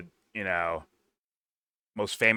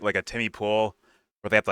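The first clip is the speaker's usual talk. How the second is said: ordinary talk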